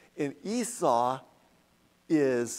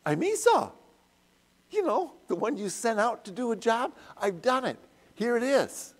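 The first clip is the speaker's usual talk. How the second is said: much higher, louder eager son; eager Esau; 7 semitones higher, 4 dB louder than usual. Retold or imitated. Teacher voices Esau, eagerly presenting the meal to his father. Teacher voicing Esau's reply.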